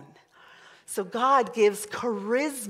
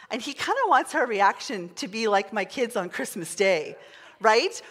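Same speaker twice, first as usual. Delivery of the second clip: ordinary delivery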